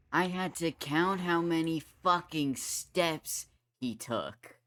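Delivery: loud, heated, exasperated rant; rage rant. Real, not acted. Gamer venting about counting his steps, heated and loud. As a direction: rant loudly, fed up with the game. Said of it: "That was the rage portion".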